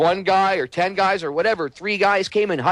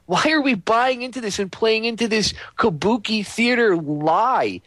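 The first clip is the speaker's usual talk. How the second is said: ordinary delivery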